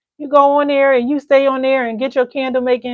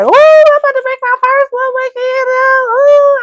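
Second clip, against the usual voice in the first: high, loud squeal of excitement; giddy about her first woodwick candle; excited; 6 dB louder than usual. Retold or imitated. Retelling her own thrill at a new candle, high and loud. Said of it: "I was all excited".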